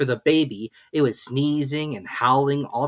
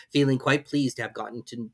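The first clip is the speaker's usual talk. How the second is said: plain reading voice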